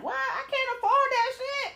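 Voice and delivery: high-pitched mock disbelief; acted, high incredulous protest; incredulous retort. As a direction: balk at it in a high voice. Acted incredulous reply to invitation.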